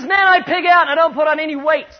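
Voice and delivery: imitating pastor's excitement. Skinny pastor quoted joking.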